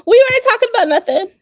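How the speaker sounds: high, fast shouted denial; loud playful denial. Real, not acted. Shouting to her sister that they weren't talking, loud protest. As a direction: yell it fast and high, all innocence.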